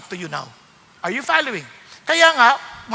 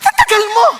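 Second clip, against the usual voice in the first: annoyed boss shout; 10 semitones higher, 8 dB louder than usual. Retold or imitated. Boss yelling at his late secretary, loud and high. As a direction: bark out the complaint from way up high. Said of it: "sabi niya".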